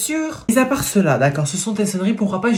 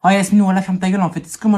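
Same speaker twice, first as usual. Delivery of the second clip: ordinary delivery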